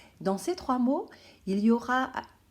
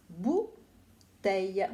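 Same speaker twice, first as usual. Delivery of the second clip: slow; much slower than usual. Shown as a demonstration. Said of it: "teacher stretching the word".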